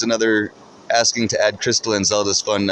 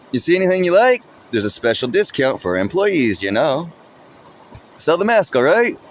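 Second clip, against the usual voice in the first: cheerful shopkeeper call; 7 semitones higher than usual. A narrator's reading. Shopkeeper calling as you leave.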